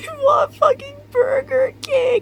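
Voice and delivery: high, slow, whiny, tearful wail; mock crying whine. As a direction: imitate your own sobbing demand. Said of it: "And I was like".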